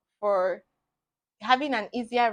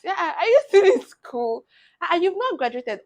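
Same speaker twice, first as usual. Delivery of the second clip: higher-pitched, nosy imitation; mock surprised questioning; 6 semitones higher, 5 dB louder than usual. Church people asking about graduation, mock surprise in a raised pitch. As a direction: imitate a churchgoer amazed you're still studying.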